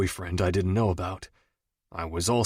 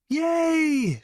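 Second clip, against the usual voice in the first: excited yell. A narrator's reading. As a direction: yell it while jumping for joy. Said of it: "she yelled".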